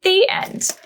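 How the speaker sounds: gentle storybook reading to little ones; reading to children. A narrator's reading. Storyteller reading to children, gently. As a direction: read it like a bedtime picture book. Reads picture book aloud.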